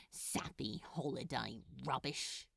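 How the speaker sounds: low, soft grumble under the breath; muttered. A narrator's reading. Crowley scoffing at the film, soft muttered grumble. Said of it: "he muttered".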